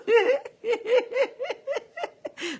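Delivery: a burst of high, squealing laughter. Real, not acted. Laughing at her own joke.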